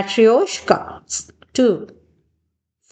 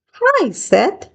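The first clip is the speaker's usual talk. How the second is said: mild mock shout; 10 semitones higher than usual. A narrator's reading. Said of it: "shouted Tanner".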